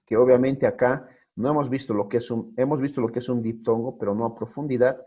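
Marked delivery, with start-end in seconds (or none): none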